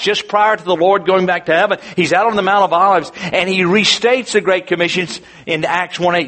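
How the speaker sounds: ordinary delivery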